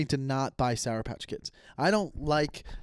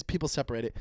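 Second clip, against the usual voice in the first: usual speaking voice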